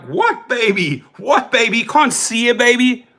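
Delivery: high, loud, incredulous repeat; mock bewildered outburst; disbelief. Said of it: "And I'm like".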